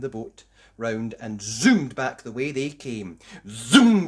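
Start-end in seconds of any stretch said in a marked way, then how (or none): 3.5-4.1 zoom. A narrator's reading. Boat zooming sound effect.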